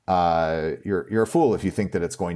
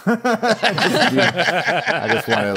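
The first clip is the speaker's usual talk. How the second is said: laughing; 13 semitones higher, 6 dB louder, much slower than usual. Real, not acted. Laughing mid-story.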